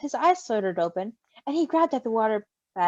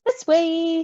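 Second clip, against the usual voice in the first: eager yell; 5 semitones higher, 5 dB louder than usual. A narrator's reading. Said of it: "he yelled".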